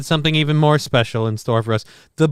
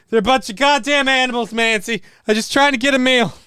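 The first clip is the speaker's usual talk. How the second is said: high-pitched put-on husband voice; complaining husband; 7 semitones higher than usual. Leopard explaining himself to his wife, in high mock complaint. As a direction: grumble in a raised comic voice. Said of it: "his wife sees him".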